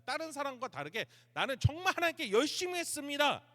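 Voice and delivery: loud, high-pitched grumbling boast; grumbling protest. Acted. Elijah grumbles to God about his zeal, indignant complaint. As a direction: protest your devotion loudly, voice pushed up. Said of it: "투덜대는 거예요".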